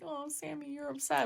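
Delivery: high, soft doting voice; mock cooing pity. Fan comforting upset Sam, mock cooing pity. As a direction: coo it gently up high. Acts pitying a favorite character.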